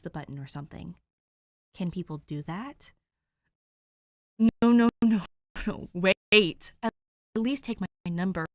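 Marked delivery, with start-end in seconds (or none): 4.4-8.4 loud, panicky no no no; panicked pleading. Acted. Dick, desperate to keep Danny from hanging up, panicked pleading. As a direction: rush to stop her leaving.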